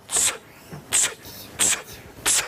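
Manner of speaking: short sharp "tss" bursts; imitated hissing noise. Storyteller showing children what Stan heard, crisp repeated hiss. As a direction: make four evenly spaced hissed ticks. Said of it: "And then he heard".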